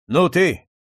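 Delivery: loud curt summons; sharp call. Acted. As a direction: snap at the servant. Count calls a man over.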